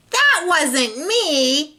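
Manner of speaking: slow, high protest; protesting cry. A narrator's reading. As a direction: drag it out in indignant denial. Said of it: "he cried".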